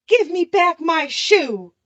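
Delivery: Dorothy snaps back for her shoe; angry demand. A narrator's reading. Dorothy demanding her stolen shoe back from the witch, angry. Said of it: "grew angry".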